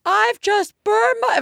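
high, slow, mock-weepy little girl; imitated child crying. Mother mimicking her crying daughter, high and slow. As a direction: whimper it like a hurt four-year-old. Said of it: "after the crying of".

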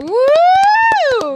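very loud, high whoop; loud cheer. Real, not acted. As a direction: whoop to wake someone up. Calling out to wake the guest.

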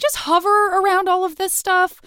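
high, pleading mock-teen voice; noncommittal teen. Host imagines the guest as a teenager, high noncommittal plea. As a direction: float it up high, asking to stay out of it. Voicing an ambivalent teen's wish.

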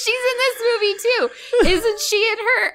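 high-pitched words through giggles; laughing. Real, not acted. Joking about Brandy with a friend, high giggling talk.

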